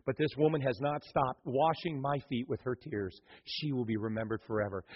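hushed, tender rebuke, low and soft; tender Jesus voice. Acted. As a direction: speak it quietly like a loving teacher defending her. Jesus answers gently, voice dropped.

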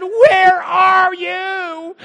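high, loud, drawn-out wail; hammed-up, stretched shriek; screaming. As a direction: scream each word out long, imitating a terrified girl. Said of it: "She screamed, again, and she said".

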